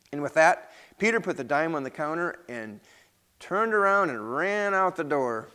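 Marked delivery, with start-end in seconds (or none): none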